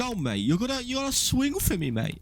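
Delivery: high-pitched mock refusal; Dudley impression. Mate refusing to talk in private, high mock voice. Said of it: "He's like".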